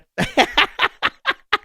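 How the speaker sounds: helpless high-pitched laughter; real amused cackle in a high register. Real, not acted. Host laughs at retold moment.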